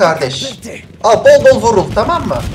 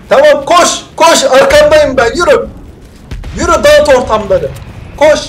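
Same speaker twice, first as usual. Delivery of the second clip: loud egging-on shouts; hyped urging; 4 semitones higher, 5 dB louder than usual. Real, not acted. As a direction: pump the teammate up. Takımı gaza getiriyor. (takım arkadaşını gaza getirme)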